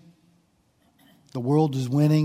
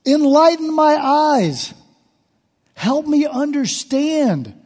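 a touch of desperate psalmist begging; pleading David; 8 semitones higher than usual. Acted. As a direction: plead with God for an answer. Preacher voices David's petition.

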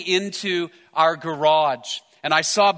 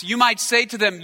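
ordinary delivery

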